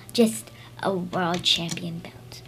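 hushed whisper, slow. Pretend. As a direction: whisper it slowly like a secret.

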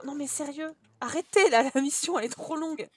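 usual speaking voice

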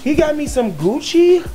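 high-pitched mock girlfriend excitement; thrilled Royalty impression. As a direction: squeal it like a thrilled girlfriend, hammed-up. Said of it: "So, I'm royalty".

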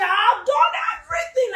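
high, strained outburst; acted wail of exasperation; desperate cry. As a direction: burst out high in frustration. Acts out a desperate believer.

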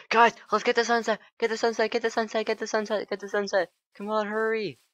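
high-pitched, rapid-fire excited chant; hurried high squeal of excitement; frantic urging. Real, not acted. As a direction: urge everyone along in a high, panicky rush. Urging all to catch sunset.

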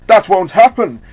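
loud, high interruption; forceful villain's objection; villainous shout. Acted. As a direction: burst in shouting.

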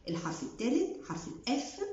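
ordinary delivery

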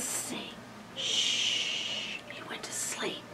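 soft hushed whisper; whisper, don't wake him. Real, not acted. Reading, child asleep.

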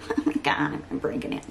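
fast, whispered mock girly panic. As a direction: play the dainty girl worried about a nail. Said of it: "Such a girl".

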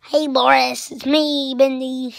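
attempted manly cartoon voice, loud; Bendy. Kid showing viewers the voice he can't do, manly Bendy voice. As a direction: have a go at a manlier character voice. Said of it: "kinda more manly, but I can't do it".

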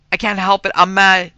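loud, forceful, high outburst; emphatic shout. A narrator's reading. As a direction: bellow it with emphasis. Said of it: "said he with emphasis".

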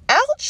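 sharp high yelp; a sudden high squeal when pinched; squeal of pain. A narrator's reading. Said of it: "squealed Katie".

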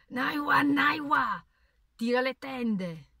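loud, high shout calling out a name; horrible gurgling shout. A narrator's reading. Wounded father shouts for his wife to draw the curtains, loud gurgling yell. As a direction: bellow it from the sickbed, then slow down on the order. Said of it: "Lo sentii urlare con quell'orribile voce gorgogliante".

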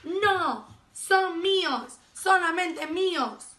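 high, loud, stretched-out protest; stubborn possessive yell, slowing on the claim; grumpy shout. A narrator's reading. Grumpy ladybug refusing to share aphids, shouting. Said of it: "gritó la mariquita malhumorada".

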